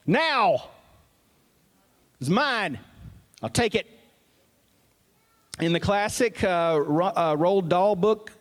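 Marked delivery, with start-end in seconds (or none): 0.1-3.9 put-on high, bossy little-kid voice; demanding toddler. A two-year-old demanding his toy back, high and bossy. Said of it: "when you're two years old and you say".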